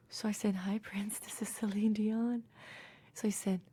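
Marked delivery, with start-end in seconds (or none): 0.7-2.4 whispered back, much softer; breathy whisper. Retold or imitated. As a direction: mirror his hushed breathy tone. Echoing [name]'s breathy greeting.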